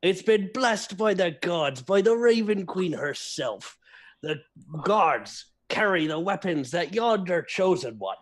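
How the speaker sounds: put-on high, lilting drunken pirate storyteller; put-on pirate brogue, pitch swooping up. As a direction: spin a grand yarn like a tipsy sea dog. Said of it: "I go".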